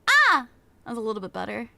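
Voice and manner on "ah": short, high, loud "Ah!"; quick burst of a test scream; demo scream. Shown as a demonstration. Streamer retesting the mic level, short high scream. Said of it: "and then I go".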